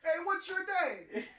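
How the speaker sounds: high slow yelled question; demanding yell. Pretend. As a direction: challenge him by yelling. Playing the video scene.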